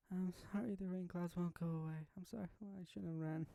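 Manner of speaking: high, tearful apology, fading soft; crying. Acted. Sayori beside sleeping Monika's hospital bed, crying. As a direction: sob the apology out. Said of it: "crying already".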